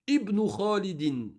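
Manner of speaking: Ibn said as ibnu, with a clear opening i, slowly; sounded hamza. Shown as a demonstration. Teacher to class, sounding the i of Ibn slowly. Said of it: "On l'entend bien ici".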